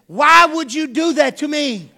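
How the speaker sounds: loud, high, slow whiny complaint; indignant kid. Retold or imitated. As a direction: protest like a wronged smart aleck. Smart aleck kid complaining.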